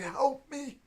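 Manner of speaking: put-on high, weak old lady pleading; frail old woman. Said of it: "grabs onto your clothes".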